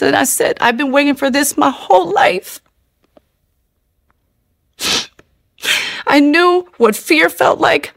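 high, choked-up voice; overcome with emotion. Real, not acted. Woman recalling meeting God in jail, choked up. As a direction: speak through rising tears. Overwhelmed by holy presence.

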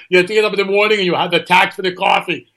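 hammed-up Austrian strongman accent; Arnold Schwarzenegger impression. Host imitating Schwarzenegger on taxes, mock Austrian accent. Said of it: "As Arnold Schwarzenegger".

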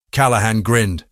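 plain reading voice

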